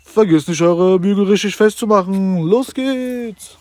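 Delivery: high, drawn-out fairground announcer; ride operator voice. Pretend. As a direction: hype the crowd for round two.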